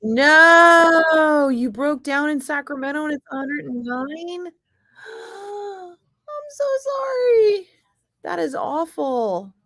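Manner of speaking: hammed-up gasp, high and slow, fake pity; sarcastic sob-story pity; mock sympathy. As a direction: pour on overdone sympathy, drawn out. Mocking sympathy for broken-down friend.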